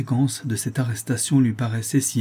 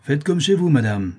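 high mock-polite invitation, dropping off; drily taunting; sardonic; 5 semitones higher, 4 dB louder than usual. A narrator's reading. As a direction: make the courtesy sarcastic. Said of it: "dit Bourdin d'un air sardonique".

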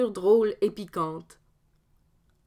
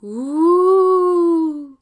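long loud rising hoot; ghostly moan; 7 semitones higher, 9 dB louder than usual. Acted. Ghost repeating its moan at Dominique, loud hoot. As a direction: howl it out like a haunting spirit.

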